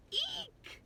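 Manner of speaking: squeaky startled gasp then eek; high gasp and eek. Acted. Larry shocked at his polka dots, high squeak. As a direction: jump in fright, playfully.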